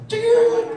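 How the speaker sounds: very high, loud imitated squeal; hamster shriek imitation. Preacher imitating the possessed hamster's scream, high shriek. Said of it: "Itu hamster bisa teriak".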